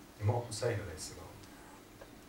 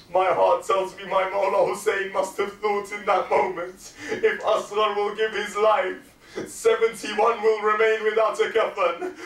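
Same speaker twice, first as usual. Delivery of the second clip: raised, loud, high mourning recitation; full-force preaching; 8 semitones higher, 8 dB louder than usual. Acted. The speaker drives the Karbala scene at full force to mourners.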